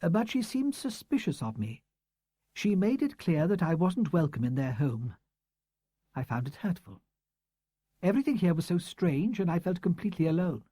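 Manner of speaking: a hint of a proud, hurt old teacher; woman's voice. A narrator's reading. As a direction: read her as a composed, defensive schoolteacher. Woman's voice in the story.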